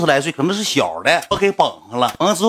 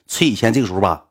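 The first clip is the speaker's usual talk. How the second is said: normal talking voice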